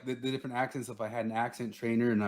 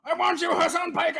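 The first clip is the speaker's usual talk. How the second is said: much higher, loud mock plea; mock squeal; 4 dB louder than usual. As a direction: squeal the name like a fanboy.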